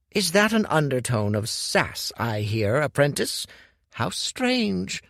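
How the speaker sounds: Hu teases Dorian about his sass; amused old master, teasing. Acted. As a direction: needle the apprentice with a smile.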